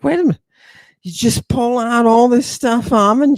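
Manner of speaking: high mock student voice; imagined skeptic. Lecturer voicing a student's objection, high and mocking. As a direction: pitch it up as the doubting listener.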